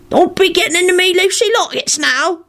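high-pitched grumbling rogue; aggrieved character voice, accusing. A narrator's reading. Mundungus warning Neville off his pockets, grumbling. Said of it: "Mundungus grumbled".

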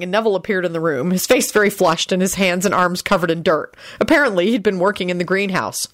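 ordinary delivery, nothing marked

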